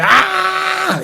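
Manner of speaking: put-on sudden high, loud scream of a startled waking boy; a sharp cry as he jolts awake; retold scream. Hero jolts back into his body, loud high scream. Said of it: "Et il crie, quoi".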